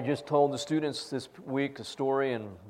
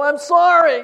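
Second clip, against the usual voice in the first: much higher, much louder apology; cornered student apologizing over the yelling; loud flustered apology; 17 dB louder than usual. Retold or imitated. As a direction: blurt out the apology at full pitch.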